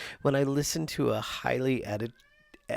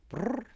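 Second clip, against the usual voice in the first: high trilled brrr; imitating the cat; 12 semitones higher than usual. Owner mimics her cat's trill, high. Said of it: "he's like".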